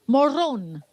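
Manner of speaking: "Morón" in a Spanish accent, rolled r, stress on the last syllable; "Morón" pronounced as in Spanish, stressed on the final syllable. Shown as a demonstration. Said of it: "I'm saying it with my Spanish accent".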